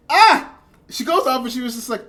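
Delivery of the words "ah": sudden loud, high "ah!"; frustrated outburst. Real, not acted. Vlogger breaking off a retold quote, frustrated outburst. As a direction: cry out sharply, breaking off mid-sentence.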